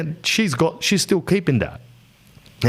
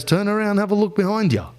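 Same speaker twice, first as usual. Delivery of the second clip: lightly voiced grandfather, pitched higher; grandfather's retort; 7 semitones higher than usual. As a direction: coolly point the wife behind her. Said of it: "And he goes".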